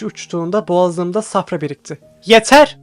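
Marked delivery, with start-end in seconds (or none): 2.3-2.8 loud, sharp "Yeter!"; shouting. A narrator's reading. Professor stopping the bloody fight with a loud shout. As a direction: bark it to end the fight. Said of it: "diye bağırdı".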